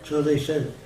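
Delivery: usual speaking voice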